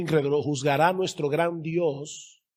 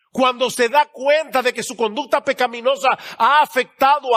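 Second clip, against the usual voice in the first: raised, emphatic preaching voice; pregação enfática; 7 semitones higher, 7 dB louder than usual. Real, not acted. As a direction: preach it out high, stretching key words.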